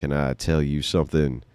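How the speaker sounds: low, soft crooning come-on; smooth pickup line. Retold or imitated. Mock pickup line to a woman.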